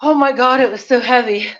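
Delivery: puffing between phrases; breathless after workout; out of breath. Real, not acted. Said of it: "let me catch my breath".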